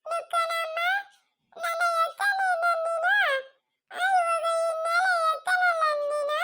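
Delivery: very high, wailing lament; slow, keening cry for the departed. Acted. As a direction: wail the words through long sobs. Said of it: "ಅಳ್ಬಾರ್ದು, ಸುಮ್ನೆ ಇರಿ".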